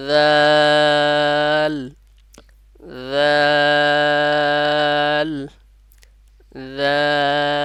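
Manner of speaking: জাল held long and loud, again and again; repeated drawn-out jal. Shown as a demonstration. Teacher drills students on জাল, long and loud. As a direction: chant জাল slowly three times, stretched for three alif. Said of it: "বারবার এভাবে বলতে হবে".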